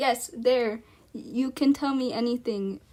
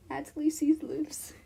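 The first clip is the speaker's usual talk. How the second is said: breathy relieved whisper; whispered aside; 5 semitones higher than usual. Real, not acted. Streamer whispering relief about a minor character. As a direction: slip it out in a whisper.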